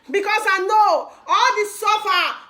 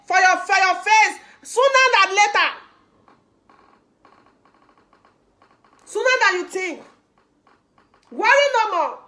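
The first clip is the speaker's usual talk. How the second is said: rousing rally preaching; 3 semitones higher than usual. Acted. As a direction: fire up the crowd with every line. Rally speech building to climax.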